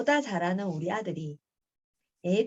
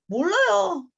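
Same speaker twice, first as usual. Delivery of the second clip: acted protest; 10 semitones higher, 8 dB louder, slower than usual. Son's sulky answer to father, acted.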